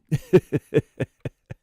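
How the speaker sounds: loud, high burst of laughter; real laugh, falling away at the end. Real, not acted. Joking about having a baby over forty, laughing.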